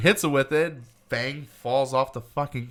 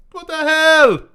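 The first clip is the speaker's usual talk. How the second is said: higher, louder shocked outburst; startled what the hell; 13 semitones higher, 10 dB louder, slower than usual. Retold or imitated. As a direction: blurt it out in shock. Character is startled.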